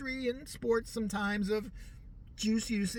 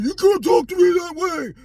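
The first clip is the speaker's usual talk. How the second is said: high, loud, much louder mock scream; hammed-up yelling wife; sassy shout; 10 semitones higher, 12 dB louder than usual. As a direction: shriek it like a furious pop star. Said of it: "She's like".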